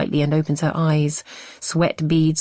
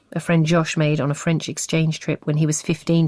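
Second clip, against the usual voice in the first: usual speaking voice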